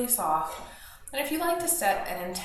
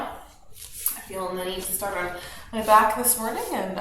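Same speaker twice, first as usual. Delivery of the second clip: ordinary delivery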